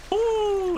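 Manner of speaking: sudden high startled ooh; being chased. Real, not acted. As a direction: yelp as the shark lunges. Shark nearly catches him.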